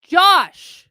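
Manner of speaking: high sharp loud call of the name. Real, not acted. Streamer calling out a chatter by name, loud and high. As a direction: call him out in front of everyone.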